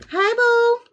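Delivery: high, cooing hello to a loved one; cooing greeting. Real, not acted. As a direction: coo it out sweetly, way up high. Greeting someone off camera.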